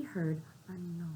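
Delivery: hushed whisper; spooky storytime whisper. A narrator's reading. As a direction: breathe it like a secret. Whispered opening of scary story.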